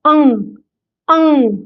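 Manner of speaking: अंग ends on a nasal ng, not ग; just अंग. Shown as a demonstration. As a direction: hold अंग and stop there. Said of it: "सिर्फ अंग". (सिर्फ अंग बोलें)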